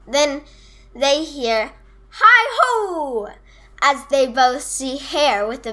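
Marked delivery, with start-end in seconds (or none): 2.1-3.3 high, drawn-out storybook hail; sing-song, high hello call. A narrator's reading. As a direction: call out a greeting from the doorway. Hare calls from the door.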